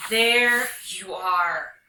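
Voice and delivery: loud, drawn-out exclamation; mother's cry. Acted. Mama Hyena as her child gallops home, crying out. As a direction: call it out slowly to a lost child. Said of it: "cried Mama Hyena".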